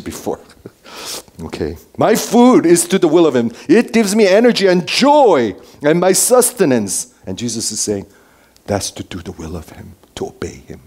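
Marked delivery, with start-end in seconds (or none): 2.0-7.1 high, energized, emphatic declaration; joyful proclamation. Acted. Preacher voicing Jesus to the congregation, exuberantly joyful. 8.7-10.8 low, soft, dropping almost to a whisper; hushed reverence. Acted. Preacher explaining what Jesus says, hushed.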